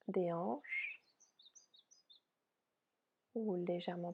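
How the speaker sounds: soft, unhurried yoga nidra guidance; softly spoken relaxation voice, spacious phrasing; guided meditation. Real, not acted. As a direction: guide them gently into rest, with long pauses.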